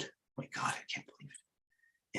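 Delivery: breathless whisper of disbelief; a soft, stunned whisper; awed whisper. Real, not acted. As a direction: hush it as if you can't believe your eyes. Stunned by a stock move.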